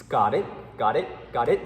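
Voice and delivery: "got it" with both T's held behind the teeth; unreleased T. Shown as a demonstration. Teacher drilling the class on unreleased T. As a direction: cut off the T without a puff of air.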